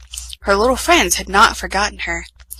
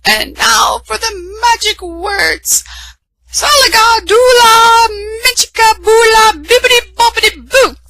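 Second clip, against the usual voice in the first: spell-casting fairy godmother; 14 semitones higher, 6 dB louder than usual. A narrator's reading. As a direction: cast the magic words grandly.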